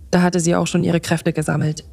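ordinary delivery

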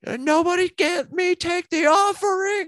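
put-on very high, loud old-woman shriek; piercing high cry from the pews; old lady screaming. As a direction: shriek it like an outraged elderly churchgoer. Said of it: "she screams out".

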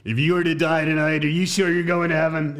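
hammed-up menacing question; mock threat. Shown as a demonstration. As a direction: ask it like a veiled threat with a rifle in hand. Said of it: "while holding a very large caliber rifle".

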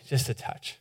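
breathy whisper, almost no voice; whispered touch. Acted. Preacher to the congregation, whispering the woman's faint reach. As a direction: hush it like a secret longing.

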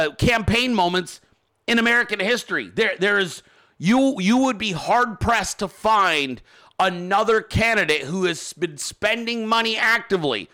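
usual speaking voice

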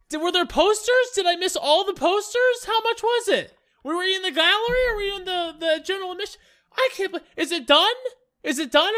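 high, loud comic disbelief; mock outrage. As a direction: panic like a fan who missed the big news. Acted shocked theatergoer hearing of play.

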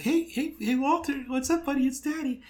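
put-on high cooing daddy voice to a baby; high sweet voice greeting an infant son; sing-song baby talk.

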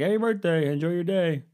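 very high-pitched old grandpa imitation; imitated grandpa. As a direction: send up a grandpa's phone farewell in a high voice. Imitates grandpa replying on the call.

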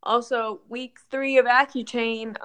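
ordinary talk, nothing marked

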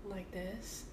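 soft, low sultry voice; sexy voice. Pretend. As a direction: purr it softly and seductively. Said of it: "Can you use your sexy voice".

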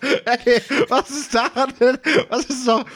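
laughing through the words. Real, not acted. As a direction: protest while laughing. Laughing while defending himself.

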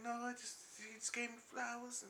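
high, very soft stammer; mock stuttering. Man retelling her response to being confronted, mock stuttering. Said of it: "she was like fucking stuttering Mike".

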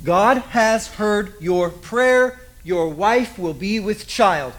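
slowed right down, every word weighted; preacher slowing to press the promise; slow emphatic retelling. Shown as a demonstration. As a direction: drive each word home slowly. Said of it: "I need you to hold on to this".

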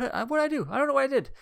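high squeaky mock panic. Ryan accused by the teacher, squeaky mock panic. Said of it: "Ryan's like".

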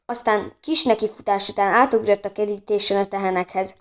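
plain reading voice

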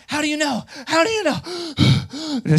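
high, slow, panicked mock whine; panicky passenger. Anxious flyer pleading with the pastor, high panicked whine. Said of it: "working herself into a frenzy".

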